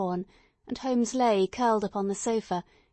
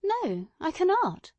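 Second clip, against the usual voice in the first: Watson conceding; 10 semitones higher, slower than usual. Acted. Watson admits, raised pitch.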